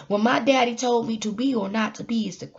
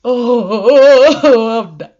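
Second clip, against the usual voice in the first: mock dramatic despair; 12 dB louder, much slower than usual. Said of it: "Sometimes I be like".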